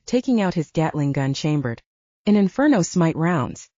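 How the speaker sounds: ordinary delivery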